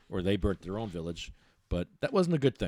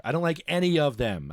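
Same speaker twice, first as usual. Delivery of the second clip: ordinary delivery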